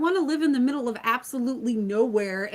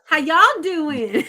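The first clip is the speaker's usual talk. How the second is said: slow, louder drawled "y'all" with a laugh; laughing Southern greeting; 6 semitones higher, 5 dB louder, slower than usual. Shown as a demonstration. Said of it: "I do it like y'all now".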